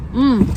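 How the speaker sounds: delighted hum of taste; loud savoring mmm. Real, not acted. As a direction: hum with pleasure at the flavor. Tasting sandwich in car.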